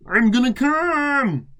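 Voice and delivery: hammed-up high shout, slowed; mock shout. Chris shouting at Matt in climax, hammed-up high yell. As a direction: bellow it out in mock ecstasy. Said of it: "shouted Chris".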